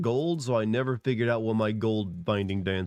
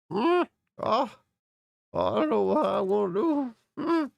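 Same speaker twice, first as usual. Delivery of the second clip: put-on much higher, rattling frail old man; old man with death rattle; 12 semitones higher, slower than usual. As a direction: groan it out like a dying grandpa. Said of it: "throw in, like, the death rattle".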